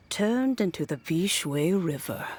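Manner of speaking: ordinary talk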